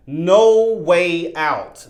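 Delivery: slow, emphatic pronouncement of doom; drawn-out menace. Retold or imitated. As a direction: stretch each word ominously. Quotes demon's terrifying line, drawn out.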